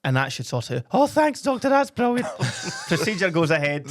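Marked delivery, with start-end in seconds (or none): 0.9-2.3 very high squeaky voice, a man pleading. Acted. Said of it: "your voice is too high".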